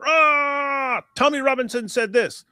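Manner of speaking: high strangled yell into a complaint; hammed-up outraged cry; mock enraged. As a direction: imitate a raging man with an opening roar. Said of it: "enraged about".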